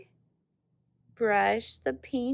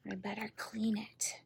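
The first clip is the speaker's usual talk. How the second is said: whispered aside; 9 dB quieter than usual. Real, not acted. Quiet aside while cleaning brush.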